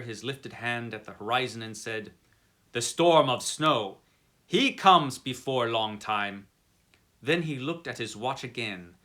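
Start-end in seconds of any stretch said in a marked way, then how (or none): 2.7-6.5 put-on slow, loud warning in a German accent; a German driver warning of snow, slowly and loudly; German coachman. Coachman warns the traveller of snow, in a loud, slow German accent.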